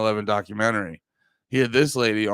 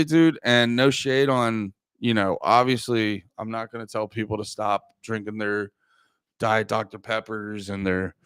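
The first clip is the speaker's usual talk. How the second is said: ordinary talk, nothing marked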